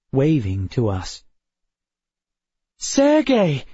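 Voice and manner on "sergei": high, loud cry of a name. A narrator's reading. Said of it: "cried Emma".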